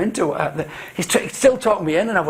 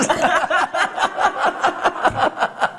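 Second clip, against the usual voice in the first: long high-pitched run of laughter; real laughter; 12 semitones higher than usual. Real, not acted. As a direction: burst out laughing and keep it going. Laughing at remark on director's confidence.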